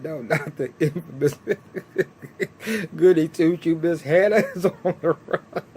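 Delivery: retold words broken up by laughter; giggling mock surprise at the goody two shoes; laughing through it. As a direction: say it while cracking up. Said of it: "she just started busting out laughing".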